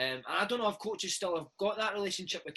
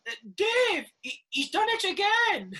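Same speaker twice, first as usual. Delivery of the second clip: high, loud shout down the stairs, stretched out slowly; hammed-up landlady yelling up a fuss; mock exasperated landlady shout downstairs; 9 dB louder, slower than usual. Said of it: "she would shout downstairs".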